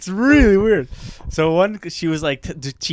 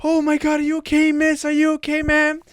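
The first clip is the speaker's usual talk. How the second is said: higher, fussing classmates; mock worried students; 9 semitones higher than usual. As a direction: fuss over the fallen teacher. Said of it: "everyone's like".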